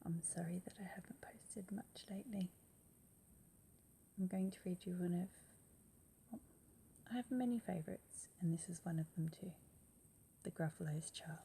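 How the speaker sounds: hushed whispered intro; soft whisper. Real, not acted. Whispering hello to her jelly bean viewers.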